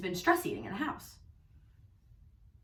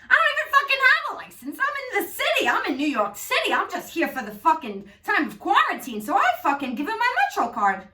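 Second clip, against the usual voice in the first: higher, louder indignant rant; outraged rant; 8 semitones higher, 4 dB louder than usual. Real, not acted. Ranting to viewers about the cop's ticket, outraged.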